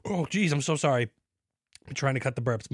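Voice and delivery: usual speaking voice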